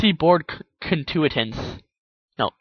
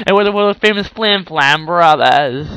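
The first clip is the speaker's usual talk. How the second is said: showman's announcement; 3 semitones higher, 6 dB louder, slower than usual. A narrator's reading. Stallions' grand introduction.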